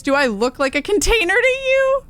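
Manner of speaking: high, loud creepy doll voice; doll guy voice. Acted. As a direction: squeak it out like a living doll. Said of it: "doll guy voice".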